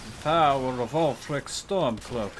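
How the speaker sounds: put-on a failed Ulfric Stormcloak Nord accent, slowed right down; clumsy Skyrim Nord accent. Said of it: "I can't do that accent properly".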